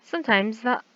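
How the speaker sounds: normal talking voice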